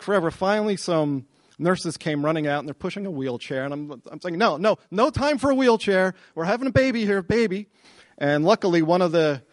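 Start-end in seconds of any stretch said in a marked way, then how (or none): 4.4-7.7 high-pitched, retold urgency; urgent protest. Father to nurses with wheelchair, urgent protest. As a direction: wave help off in acted alarm.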